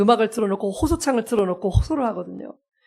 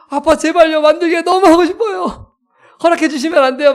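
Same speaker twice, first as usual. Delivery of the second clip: higher, louder, insistent begging; pleading child; 6 semitones higher, 5 dB louder than usual. Retold or imitated. Child begging dad by chat mid-sermon, pleading. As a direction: imitate a child pestering dad with an urgent plea.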